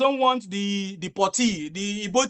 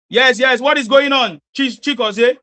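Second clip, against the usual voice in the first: raised calling voice, high and loud; to someone hard of hearing; 4 semitones higher, 8 dB louder, slower than usual. Real, not acted. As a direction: call out to a faint caller. Host calling caller on bad line.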